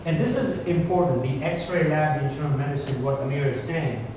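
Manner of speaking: normal talking voice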